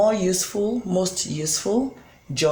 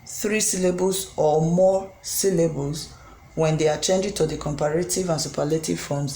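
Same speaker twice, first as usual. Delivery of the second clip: ordinary delivery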